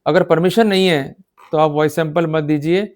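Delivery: normal talking voice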